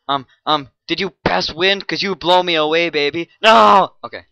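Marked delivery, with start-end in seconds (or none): 0.9-3.3 cheesy pickup line. Acted. 3.4-4.0 loud frustrated no; exasperated shout. Real, not acted. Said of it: "frustrated outburst".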